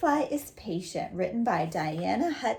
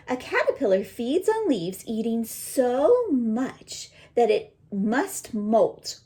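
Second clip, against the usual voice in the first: louder, expressive storybook read; reading to children; 4 semitones higher, 8 dB louder than usual. Acted. Teacher reading the butterfly book aloud to her students. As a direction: read it out big for the class.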